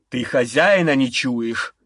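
slow, low demon rebuke. Acted. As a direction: growl it like a demon lord. Demon lord rebukes servants.

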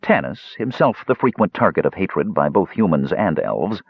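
ordinary delivery

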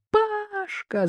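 high, drawn cry without real volume; calling out. A narrator's reading. Daughter summons her brother, high alarmed call. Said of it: "закричала дочь".

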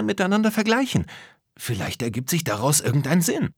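high-pitched, eager idea-pitching voice; high character voice, bubbling with a plan; Lisa, eager girl character. Acted. Lisa proposes a plan to her friends, eager high voice.

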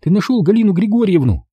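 high, hesitant woman's voice; timid young woman. Acted. Tatiana timidly asks Dima about Galina, high and hesitant. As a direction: ask him cautiously.

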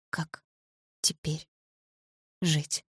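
hushed, word-by-word despair; numb echo. A narrator's reading. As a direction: repeat it softly, a long pause after each word. Narrator numbly echoes the painful words.

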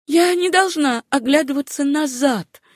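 high, urgent self-command; insistent. A narrator's reading. Susanna tells herself not to look back, high and insistent. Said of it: "твердила она".

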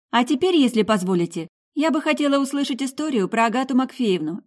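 a lightly voiced nosy healer pressing on; bride-to-be bombarding the mother; rapid-fire questions. A narrator's reading.

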